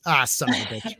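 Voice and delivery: normal talking voice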